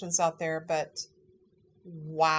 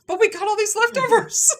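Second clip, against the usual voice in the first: high, loud burst of glee; loud delighted punchline, pitched way up; gleeful; 13 semitones higher, 9 dB louder than usual. Real, not acted. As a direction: cheer the silver lining, about to laugh. Gleeful punchline, then laughing.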